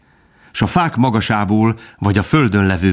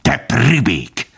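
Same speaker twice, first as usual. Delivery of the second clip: slow, raised, hateful hiss; hissed contempt; 4 semitones higher, much slower than usual. A narrator's reading. Fox insulting the dog, hateful drawn-out hiss. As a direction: hiss it, tense with loathing.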